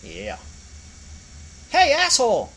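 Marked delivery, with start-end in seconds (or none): 1.7-2.5 high, loud shout from below; a tiny angry squirrel yelling; pitched-up squeaky yell; small squirrel voice. Acted. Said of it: "a small voice behind him".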